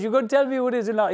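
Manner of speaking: demanding an answer from a prank caller, fast and loud; fast mock demand. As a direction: rattle it off, fed up. Own demand acted in story.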